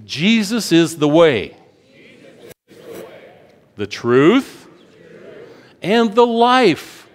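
slow, building to a high ringing "life"; rising preaching cry. Acted. Preacher declaring Jesus to the congregation, climbing. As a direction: swell to a shout on the last word.

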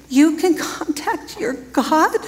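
drawn out as if she can't believe it; amazed question. Retold or imitated. Preacher replays the masseuse's astonished question, slow and rising.

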